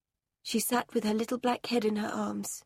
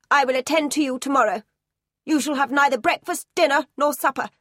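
loud, fast, clipped-off punishment; severe headmistress; 3 semitones higher, 6 dB louder, faster than usual. A narrator's reading. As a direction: hand down the sentence like a stern schoolmistress. Minchin punishes Sarah.

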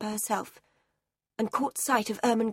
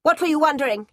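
higher, loud pressing question; sharp headmistress; 5 semitones higher, 7 dB louder, faster than usual. A narrator's reading. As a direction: press the girl like an angry schoolmistress. Minchin presses her.

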